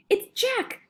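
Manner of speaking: very high-pitched cry of terror; panicked bellow. A narrator's reading. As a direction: squeal out the dreaded name. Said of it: "bellowed the flesh lump eater".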